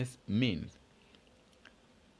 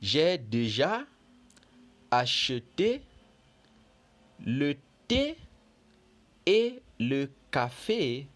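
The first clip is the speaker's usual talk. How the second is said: dialogue read aloud; 3 semitones higher, slower than usual. Acted. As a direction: read it like a lesson dialogue, slowly and carefully. Teacher reads the fairy's lines.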